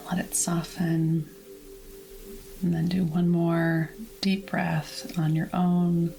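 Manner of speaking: calm, measured meditation voice; guided relaxation. Acted. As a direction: guide listeners gently through releasing tension, with long pauses. Leads whole body relaxation.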